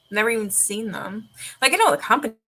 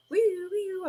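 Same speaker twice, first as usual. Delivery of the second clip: blender noise; 10 semitones higher than usual. Retold or imitated.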